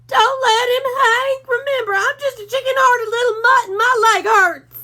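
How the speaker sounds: a timid pup whimpering to his boss; scared Drover. A narrator's reading.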